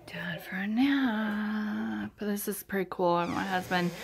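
slow, soft whispered bedtime line. Real, not acted. Mother whispering to her boy at naptime, soft and slow. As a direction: hush it out slowly for the nap.

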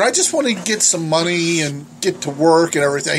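ordinary talk

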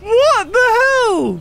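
high, drawn-out shocked cry; stunned disbelief. Real, not acted. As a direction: gamer baffled by what the game just did, shocked. Shocked by a game glitch.

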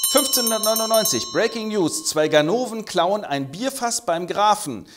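hammed-up news anchor; breaking news flash. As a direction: sell it like a breaking headline.

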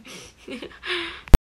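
high, loud burst of laughter; loud rising laugh; real laugh. Real, not acted. Laughs while ending her birthday wish.

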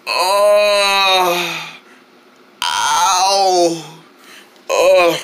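slow drawn-out moans; long, weary ows and uhs; pained groan. Acted. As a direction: groan as if lying hurt. Hurt after being burnt.

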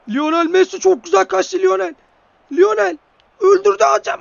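high, loud yell at the screen; excited goal shout. Real, not acted. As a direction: roar it like a goal just went in.

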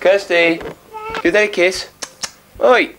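calling out. Real, not acted. Louder coaxing call to baby.

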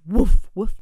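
two loud barking woofs. A narrator's reading. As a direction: bark twice like a dog. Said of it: "Smudge sniffed".

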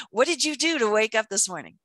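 ordinary talk, nothing marked

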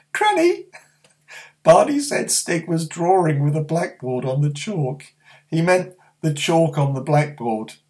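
high, giggly little sister; teasing sister. Acted. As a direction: tell on him with a giggle. Lou's voice.

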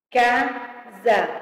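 "Casa" in two spaced beats, ca-sa; syllable by syllable. Shown as a demonstration. Teacher separating words for pupils, syllable by syllable. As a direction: split "Casa" with a gap between syllables. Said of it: "separação de palavras e sílabas".

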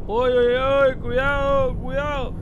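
sudden loud alarmed cry, then settling; startled warning shout. Real, not acted. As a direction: yelp a warning as the bus swerves. Bus swerves, alarmed warning. (grito de alarma repentino)

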